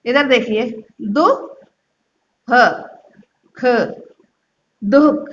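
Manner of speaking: दु, ह, ख spoken apart then joined as दुख; split into parts. Shown as a demonstration. Teacher breaking दुख into sounds for pupils. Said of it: "आपको इसको कैसे पढ़ने होगा".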